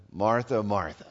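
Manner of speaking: a tender, loving call to a friend; words of love. Retold or imitated. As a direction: say her name gently, full of love. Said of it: "Those are words of love".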